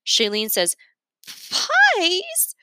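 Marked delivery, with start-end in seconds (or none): 1.5-2.6 very high rising disbelieving Pies?; shocked high question; incredulous pies. A narrator's reading. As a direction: squeak it out in disbelief. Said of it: "She stutters the P".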